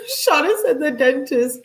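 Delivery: amused laugh running through the words. Real, not acted. Reading a friend's texts aloud, laughing. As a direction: laugh it out, tickled by the reveal.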